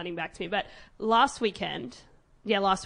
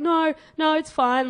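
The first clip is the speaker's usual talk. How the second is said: higher, louder retold protest; imitated insistence; 7 semitones higher, 7 dB louder, slower than usual. Imitating the girl to her auntie, insistent protest. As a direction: imitate someone brushing off worry on the phone.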